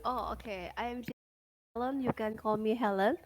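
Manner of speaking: ordinary delivery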